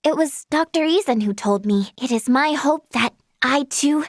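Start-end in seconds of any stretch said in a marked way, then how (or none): none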